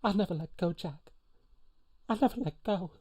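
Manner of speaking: high, thin-pitched plea with pauses; movie quote impersonation. Acted. Host impersonating a film farewell to Jack, high-pitched plea. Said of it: "I hope you're loving all my impersonations".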